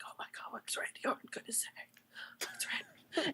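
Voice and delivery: excited, awed stage whisper; mock-suspense whispering; hushed crowd whisper. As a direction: whisper it like a crowd holding its breath.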